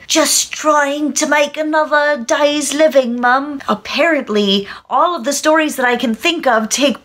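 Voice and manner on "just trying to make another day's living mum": put-on London street thief accent, pitched up; slow, pleading London urchin accent. Thief explaining himself to Mum, put-on London accent. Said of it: "a thief who doesn't want to be seen".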